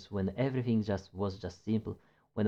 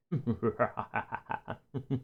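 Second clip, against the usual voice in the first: soft giggle; 2 semitones higher, 3 dB quieter than usual. Real, not acted. Laugh after whisper.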